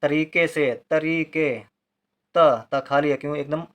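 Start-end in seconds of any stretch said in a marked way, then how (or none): 2.3-2.7 त alone, short and firm; short, louder त with no long vowel; said separately. Shown as a demonstration. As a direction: detach त as its own short syllable. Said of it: "अलग से बोला जा रहा है".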